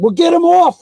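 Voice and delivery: high, loud urgent demand; retold frantic yell; panicked mimicry. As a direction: beg urgently to be rescued. Bitten friend demands rescue.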